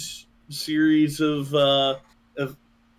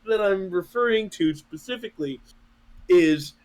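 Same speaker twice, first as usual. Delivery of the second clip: ordinary delivery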